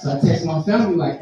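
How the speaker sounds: ordinary talk, nothing marked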